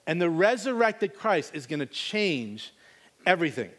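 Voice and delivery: slow, deliberate words; slowed-down sermon emphasis; slow emphatic preaching. Acted. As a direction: lean on each word to land it.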